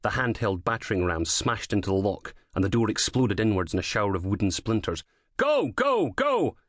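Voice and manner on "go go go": shouted command. A narrator's reading. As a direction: bark the order to storm in. Police raid, breaching the door.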